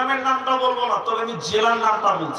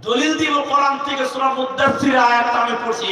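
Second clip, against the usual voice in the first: loud, raised preaching voice; fiery preaching; 4 semitones higher, 5 dB louder than usual. Acted. Preacher proclaiming proof to the audience, loud and fiery. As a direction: proclaim it to the crowd.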